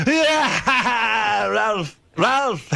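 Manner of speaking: put-on high, loud, delighted Italian accent; hammed-up Italian salesman shouting a name he knows. Salesman recognizing the stylist's name, loud Italian accent. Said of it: "he had some foreign accent".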